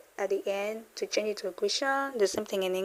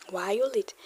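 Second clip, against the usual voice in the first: a breathy aside; whispered; much faster than usual. Shown as a demonstration. Teacher whispers the English meaning. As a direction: whisper the translation.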